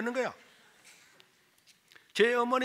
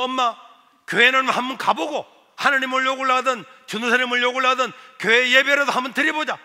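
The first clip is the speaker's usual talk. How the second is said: tag of urging son; 4 semitones higher, 8 dB louder than usual. Retold or imitated. Son persuades mother to come.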